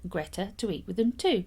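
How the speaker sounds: usual speaking voice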